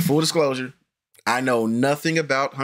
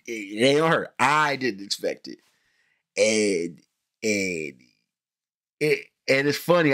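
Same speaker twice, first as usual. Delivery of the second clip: usual speaking voice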